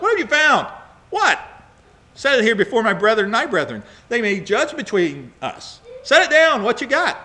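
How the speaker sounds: loud, high, indignant challenge; angry chiding. Acted. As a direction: act out Jacob demanding answers from his accuser. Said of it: "He's very angry".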